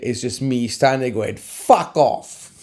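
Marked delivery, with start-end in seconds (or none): 1.4-2.5 mock outburst, higher in pitch; retold outburst. Losing contestant reacting to the winner's name, retold outburst. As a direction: blurt it into imagined silence. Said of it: "Everything goes quiet, and all you can hear is".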